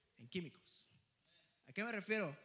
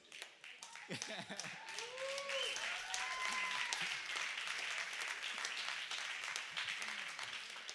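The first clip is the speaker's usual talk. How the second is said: long burst of laughter; 6 dB louder than usual. Real, not acted. Laughing at his own story.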